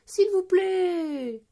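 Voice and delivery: high, loud, drawn-out plea; pleading cry. A narrator's reading. Children begging their ski teacher, pleading cry. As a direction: beg like a chorus of children. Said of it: "crient les enfants en chœur".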